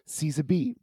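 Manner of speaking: ordinary delivery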